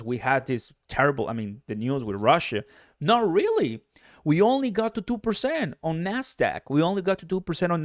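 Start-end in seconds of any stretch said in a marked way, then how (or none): 3.0-7.8 higher, amazed disbelief; surprised. Real, not acted. Host surprised the Nasdaq only fell two percent, pitched up. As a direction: marvel that the drop was so small. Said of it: "I was very surprised".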